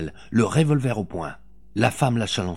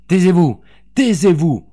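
loud, high, urgent command; shouted order; 8 semitones higher, 10 dB louder than usual. A narrator's reading. Mother silencing the woman before her son hears, shouted order. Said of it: "ordonna Véronique".

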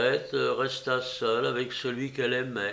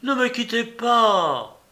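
high, loud, drawn-out cry; exclaimed plea; 8 semitones higher, 7 dB louder, slower than usual. A narrator's reading. Patient begging the fleeing young woman to stay, crying out. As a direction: slowly cry out, pleading with them to stay. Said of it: "s'écria-t-il".

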